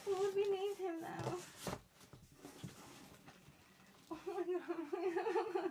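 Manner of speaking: high, soft, weepy coo; half crying with love; teary whimper. Real, not acted. Vlogger softly weeping with love for her robot. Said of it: "I cry".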